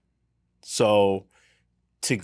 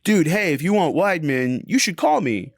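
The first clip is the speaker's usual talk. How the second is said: higher and louder, a breezy surfer read of the text; surfer dude; 6 semitones higher, 3 dB louder than usual. Retold or imitated. Rereading the agent's text the friendly way, as a surfer dude. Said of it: "surfer dude".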